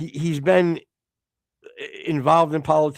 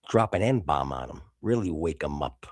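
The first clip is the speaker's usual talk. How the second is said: low character voice; 10 semitones lower, 5 dB quieter than usual. Acted. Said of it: "in character".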